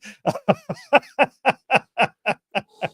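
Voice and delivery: long, high belly laugh; helpless laughter. Real, not acted. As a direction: crack up completely. Cracks up at the story.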